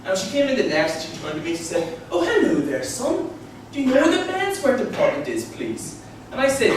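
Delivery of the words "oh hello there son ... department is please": high, gushing mum voice; sing-song high politeness, sweetly overdone; over-friendly mum. Retold or imitated. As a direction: imitate an over-enthusiastic, lovely mum. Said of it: "she was really over-enthusiastic".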